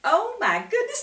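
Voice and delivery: usual speaking voice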